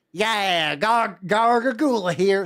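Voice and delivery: loud, high, drawn-out mock introduction; goofy character voice. Joking streamer posing as Gargagula, goofy character voice. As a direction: announce yourself as a silly creature, loudly.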